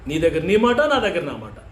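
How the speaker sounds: ordinary talk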